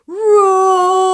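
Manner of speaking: huge, high, loud roar; loud roaring lion cry. Acted. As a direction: roar like an angry lion waking up. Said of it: "Leo woke with a thunderous growl".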